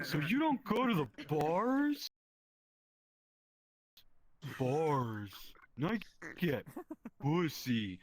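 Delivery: lightly voiced boastful coworker; coworker impression. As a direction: brag it slowly and bluntly. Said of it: "he talks like this".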